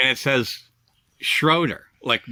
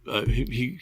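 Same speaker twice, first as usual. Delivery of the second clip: normal talking voice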